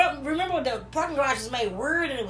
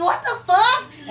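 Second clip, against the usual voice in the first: high, loud and drawn out; shocked outburst; 9 semitones higher, 7 dB louder, much slower than usual. Retold or imitated. Reacting in disbelief.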